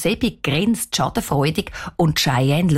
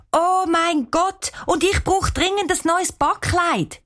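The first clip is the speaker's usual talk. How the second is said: put-on excited girl; 9 semitones higher than usual. A narrator's reading. Girl gasps over list.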